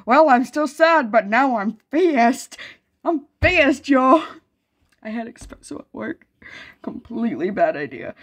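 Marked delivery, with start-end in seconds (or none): 0.1-4.2 wired and loud. Retold or imitated. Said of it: "It's just like".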